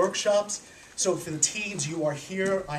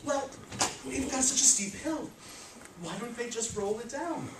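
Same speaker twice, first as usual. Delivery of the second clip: stranger's suggestion; 5 semitones higher, 4 dB quieter than usual. Retold or imitated. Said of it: "and he said".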